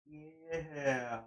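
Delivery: ordinary talk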